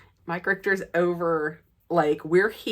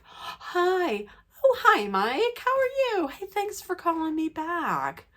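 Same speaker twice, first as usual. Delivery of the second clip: high, overly sweet greeting; sweet phone voice; 11 semitones higher than usual. Retold or imitated. Fan greeting the star sweetly on the phone. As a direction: sound extra nice to win him over.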